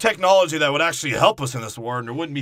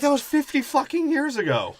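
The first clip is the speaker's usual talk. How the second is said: much higher, exasperated mock retort; high, hammed-up exasperation; mock exasperated woman; 13 semitones higher than usual. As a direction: pitch it way up in outrage.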